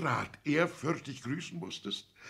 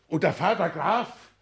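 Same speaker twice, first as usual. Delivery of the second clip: louder, higher, indignant father; outraged outburst; 6 semitones higher, 7 dB louder than usual. Acted. Father scandalized by son's noble in-laws, outraged. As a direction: throw it at him in disbelief.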